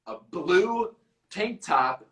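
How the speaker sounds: ordinary delivery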